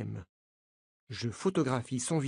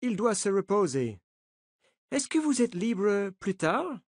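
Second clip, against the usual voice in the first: put-on the coach, higher, with an American accent; 5 semitones higher than usual. A narrator's reading. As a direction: reassure the journalists in an American accent. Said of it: "avec un accent américain". (accent américain, rassurant)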